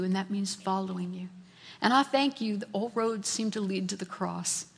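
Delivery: humble prayer. Acted. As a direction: pray it quietly, surrendering.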